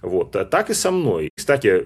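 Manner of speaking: usual speaking voice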